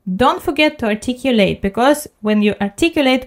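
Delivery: ordinary talk, nothing marked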